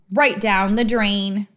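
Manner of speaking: usual speaking voice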